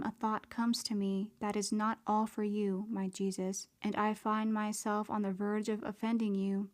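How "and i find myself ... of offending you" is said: ordinary delivery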